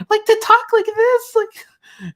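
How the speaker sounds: very high, falsetto cartoon squeak; Mickey Mouse impression. Shown as a demonstration. Streamer showing chat why that voice hurts, squeaky Mickey Mouse impression. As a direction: strain up into a loud, squeaky mouse voice. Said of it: "it really strains me to do that voice".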